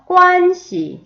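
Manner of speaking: 관 씨 with 관 high, loud and level, 씨 lighter; high first tone. Shown as a demonstration. Teacher drilling tones, 관 씨 high and level. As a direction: hold 관 high, then release 씨. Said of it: "성조 연습 들어갈게요".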